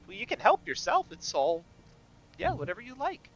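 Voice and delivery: obliging innkeeper. Acted. Game master plays Sephi.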